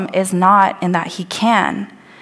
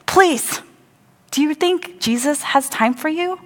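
rebuking; 6 semitones higher than usual. Retold or imitated. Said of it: "They're saying".

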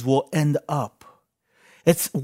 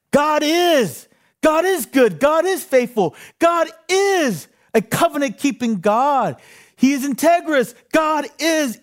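high, ringing preacher's proclamation; soaring altar-call preaching, slowing on the last line; full-force preaching; 8 semitones higher than usual. Acted. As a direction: lift the church, proclaiming every line. Preacher builds to a climax.

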